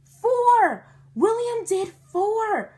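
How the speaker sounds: high, loud cheer; shouted. A narrator's reading. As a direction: shout the count. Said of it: "we shouted".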